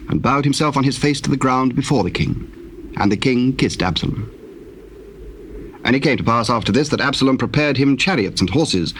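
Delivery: normal talking voice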